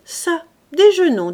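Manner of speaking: high, loud, hearty demand for lunch; a pushy squire making himself at home; boisterous lord. Acted. Lord ordering the gardener to serve lunch, loud and hearty.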